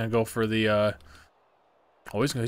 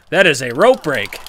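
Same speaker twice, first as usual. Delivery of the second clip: loud, outraged insistence; indignant shout; 3 semitones higher, 8 dB louder than usual. Real, not acted. Gamer yelling at the game ref, outraged. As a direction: yell it at the ref. Protesting the rope break.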